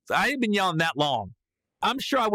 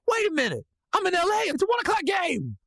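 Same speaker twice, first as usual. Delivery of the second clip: high-pitched excited realization; sudden realization; 13 semitones higher than usual. Retold or imitated. Comedian realizing he will catch the game, high and excited. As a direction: let the good news lift your voice right up. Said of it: "Then it hit me".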